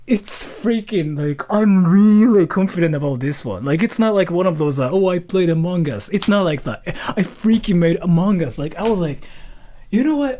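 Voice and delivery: excited. Real, not acted. Excited pitching video.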